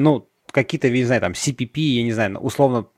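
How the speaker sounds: usual speaking voice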